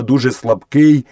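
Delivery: ordinary delivery, nothing marked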